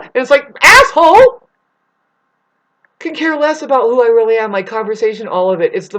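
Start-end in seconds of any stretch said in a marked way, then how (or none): 0.6-1.5 full-throated angry insult; furious shout. Real, not acted. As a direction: yell it high and loud. Angry outburst at those people.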